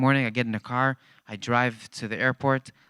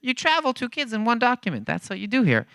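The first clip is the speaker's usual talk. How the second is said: higher, matter-of-fact guide voice; casual Jacob; 10 semitones higher than usual. Retold or imitated. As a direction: explain it like it's obvious. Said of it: "he says".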